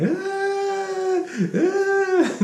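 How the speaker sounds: high, wailing mock sob; a child's hammed-up crying wail; imitated crying wail. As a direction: wail like a little girl crying. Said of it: "she starts crying".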